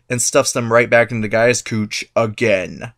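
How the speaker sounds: ordinary talk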